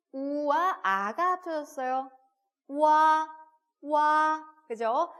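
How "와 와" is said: 와 loud and held on a level pitch; model pronunciation. Shown as a demonstration. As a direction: say 와 as 'u' gliding into 'a'. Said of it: "와 느낌이죠".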